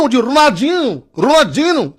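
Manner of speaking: high, sing-out Brazilian "Ronaldinho"; mock Brazilian accent. Brazilians correcting how he says the name, mock Brazilian accent. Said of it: "les Brésiliens".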